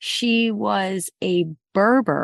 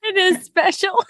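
high sentimental put-on; high mock-sentimental hoarder; 11 semitones higher, 3 dB louder than usual. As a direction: coo it like a precious keepsake.

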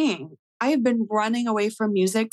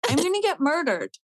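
mock panic; 8 semitones higher than usual. Retelling her stage fright to friends, higher mock panic. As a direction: pitch it up as the fear grows. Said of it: "I was like".

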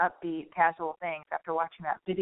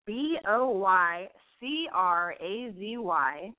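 each letter slow and separate; slow, deliberate spelling for the audience; spelled out slowly; much slower than usual. Shown as a demonstration. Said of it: "I'm gonna spell it out for you".

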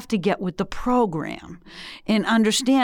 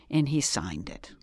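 whispered in Boston-style disbelief, softer; hushed aside; 4 semitones lower, 7 dB quieter than usual. Real, not acted. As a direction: drop to a whisper, hardly believing it. Disbelief at governor signing.

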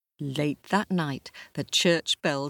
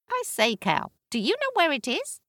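much higher, brisk braying donkey; 12 semitones higher than usual. Acted. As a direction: bray and chatter cheerfully. Donkey character.

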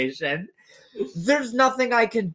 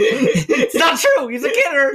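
laughing denial; 4 semitones higher, 4 dB louder than usual. Real, not acted.

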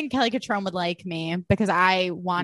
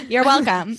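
laughing; 3 semitones higher, 4 dB louder than usual. Real, not acted. Joking back, laughing.

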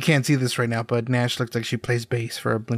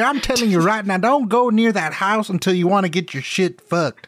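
crazy country uncle, pitched way up; country accent; 6 semitones higher than usual. Acted. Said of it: "when you do a country accent".

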